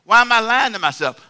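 fired-up pulpit question; emphatic preaching shout. Acted. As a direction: throw the question out at full force. Preacher exclaims.

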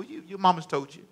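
ordinary talk

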